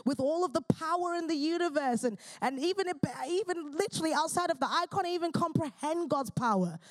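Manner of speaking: high-pitched, impassioned praying; fervent prayer. Real, not acted. Preacher crying out to the Father for his kingdom, fervent prayer. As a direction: pray it out earnestly, pitch lifted.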